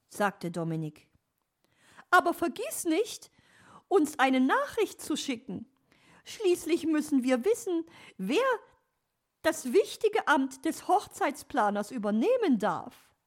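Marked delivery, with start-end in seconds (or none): 2.1-13.1 loud, very high bird-lady voice; insistent magpie. Acted. Magpie demands news of the outcome, high insistent voice. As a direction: remind them pointedly as the magpie.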